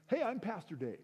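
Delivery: high-pitched eager introduction; Pastor Dave. Retold or imitated. Pastor introducing himself at the door, high and perky.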